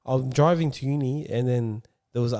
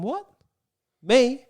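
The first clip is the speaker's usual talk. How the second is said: high, disbelieving questions, louder at the end; mock shock; 10 semitones higher, 6 dB louder than usual. Driver hearing of suspension, in mock shock. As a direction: act taken aback, playing innocent.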